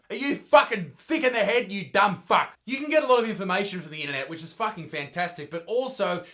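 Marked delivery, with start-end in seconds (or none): 0.1-3.9 loud, raised-pitch exasperated rant; indignant, heated outburst; angry berating. Acted. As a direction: lay into someone loudly for being stupid. Berating the imagined believer of tweet.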